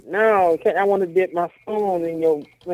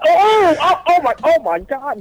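delighted disbelief; 8 semitones higher than usual. Real, not acted. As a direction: squeal it out in shock.

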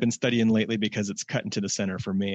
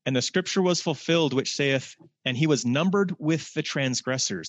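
higher, formal scripture reading; 3 semitones higher than usual. Acted. Preacher reading Mark to the congregation, formal and solemn. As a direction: read it as a proclamation.

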